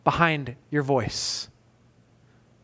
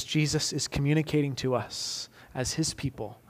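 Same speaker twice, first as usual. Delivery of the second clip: softer, lower, intimate pastoral tone; soft reflective preaching; 3 semitones lower, 3 dB quieter than usual. Acted. Pastor asks congregation to hear Jesus, soft tender preaching. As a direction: draw the congregation in gently. Quiet reflective close of sermon.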